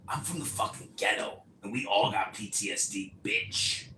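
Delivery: lightly voiced street tough; a hint of a rough hotline operator; gangster operator. Hotline operator scolding the caller, a hint of street tough. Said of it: "most gangster ass motherfucking customer service operator".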